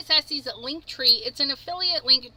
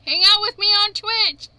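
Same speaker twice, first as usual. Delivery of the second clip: excited; 8 semitones higher, 7 dB louder than usual. Real, not acted. Invites viewers to Twitch.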